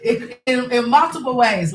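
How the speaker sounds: high, laughing through the words. Real, not acted. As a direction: keep laughing as you speak. Still laughing after the joke.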